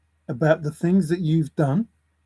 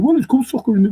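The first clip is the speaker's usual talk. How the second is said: higher, louder mocking voice of the people who say it; a raised, louder quote mocking the soccer crowd; mock quote of others; 8 semitones higher, 5 dB louder, much faster than usual. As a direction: parrot what some people say, raised and louder. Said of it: "I know some people say".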